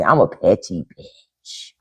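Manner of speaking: slow whispered confession. Real, not acted. Whispering her petty side to viewers. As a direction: whisper it like a guilty secret.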